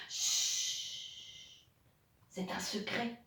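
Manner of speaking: unvoiced hush; whispered shush. Reading a secret book aloud, whispered shush.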